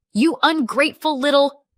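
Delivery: high loud furious outburst. A narrator's reading. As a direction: lash out at her.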